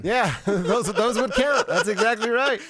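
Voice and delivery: high-pitched talk through laughter; amused, laughing, pitched well up. Real, not acted. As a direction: agree while cracking up. Cracking up at named schools.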